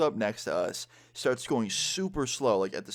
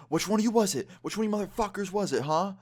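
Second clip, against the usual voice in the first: much higher-pitched mock angry rant; imitating angry drunk girl; 10 semitones higher than usual. Drunk girl confronts the group about who did it, imitated anger. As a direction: accuse them in an imitated drunk girl's voice. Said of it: "more slurred and angry".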